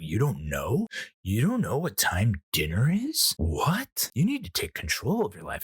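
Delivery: mock disbelief, pitch leaping up on the questions; incredulous. Friends grilling him about dinner time, incredulous. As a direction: imitate someone aghast at the answer.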